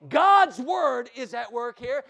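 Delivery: slow, loud, weighty preaching line; slow and loud, driving it home; emphatic. Real, not acted. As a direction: lean hard on every word.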